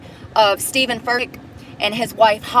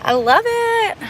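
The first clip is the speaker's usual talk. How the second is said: high, sing-song mock delight; sarcastic; 11 semitones higher, slower than usual. Real, not acted. Mocking a critic, high fake delight. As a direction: chirp it with fake cheer.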